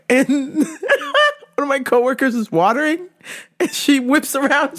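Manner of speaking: normal talking voice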